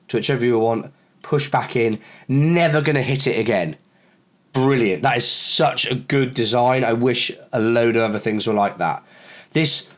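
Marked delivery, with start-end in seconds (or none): none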